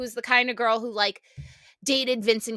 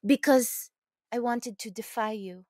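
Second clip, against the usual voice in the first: put-on French accent. Imitating the French coworker answering the owner, French accent. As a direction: coo it like a coy French coquette. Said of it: "French porn voice".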